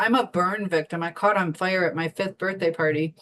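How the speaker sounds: ordinary delivery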